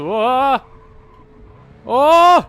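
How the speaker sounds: lauter, hoher, erschrockener Ausruf; plötzlich laut aufschreien vor Schreck. Real, not acted. Crash in the race. (erschrocken aufschreiend)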